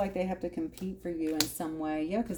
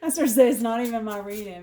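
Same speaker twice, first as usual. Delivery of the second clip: laughing it off, louder and fast; 6 semitones higher, 9 dB louder, faster than usual. Real, not acted. Reader laughing at getting emotional over Sag reading. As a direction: spill the words out through a laugh.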